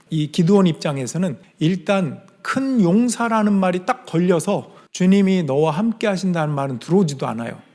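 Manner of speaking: ordinary talk, nothing marked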